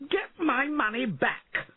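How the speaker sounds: high, drawn-out furious order; outraged storybook lord shouting; angry shouting. A narrator's reading. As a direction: order them in a high frantic yell. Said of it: "he shouted at them".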